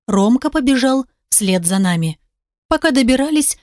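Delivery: ordinary delivery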